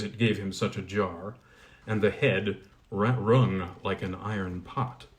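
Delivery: ordinary delivery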